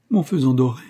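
plain reading voice

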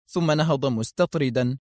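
ordinary delivery, nothing marked

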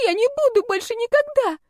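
high, panicky squeal; desperate pleading. A narrator's reading. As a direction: shift the blame in a terrified squeak. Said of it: "взмолилась девица".

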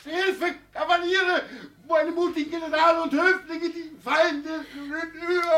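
very high, loud, frantic calling; desperate cry for help. Acted. Old man cries to his courtiers for rescue, frantic. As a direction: call out to imaginary generals.

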